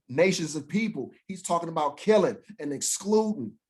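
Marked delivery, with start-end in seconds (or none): none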